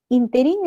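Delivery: Interín stressed on the last syllable; Interín said as in-te-RÍN; common mispronunciation. Retold or imitated. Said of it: "a varias personas le he escuchado decir".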